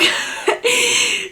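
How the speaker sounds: bright high burst of laughter; laughing. Real, not acted. Laughing about the podcaster saying she often blows her nose. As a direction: crack up at the remark.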